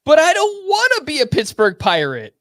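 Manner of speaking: high, loud whiny complaint; whining voice. Acted. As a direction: whine it like a spoiled kid. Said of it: "I have to say this in a whining voice".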